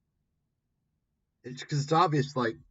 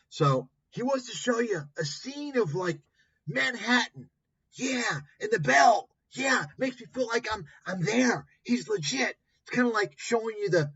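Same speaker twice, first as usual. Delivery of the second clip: high sarcastic mock-awe, louder; sneering fake admiration of the setup; 10 semitones higher, 4 dB louder than usual. Real, not acted. Mocking a finance guru's Manhattan backdrop, high sarcastic voice.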